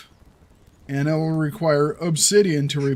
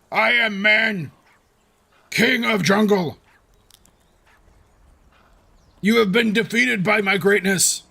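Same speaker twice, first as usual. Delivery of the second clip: caveman voice; 3 semitones higher than usual. Pretend. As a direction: boom it like a proud caveman. Caveman victory boast.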